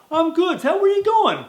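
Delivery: high, loud eager wolf voice; a skinny wolf, high and loud; scrawny wolf. Acted. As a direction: pipe up like a little wolf.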